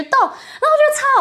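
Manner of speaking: high, laughing through the words. Real, not acted. Aunt recalling the baby's faces, laughing. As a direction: crack up as you say it.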